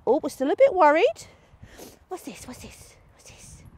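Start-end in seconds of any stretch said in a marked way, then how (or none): 2.1-2.5 much softer gentle "What's this?" to the dog; soft coaxing. Real, not acted. Trainer to nervous Magpie, softly coaxing "What's this?". 2.5-3.6 "What's this?" breathed in a soft whisper. Real, not acted. Trainer whispering "What's this?" to nervous Magpie.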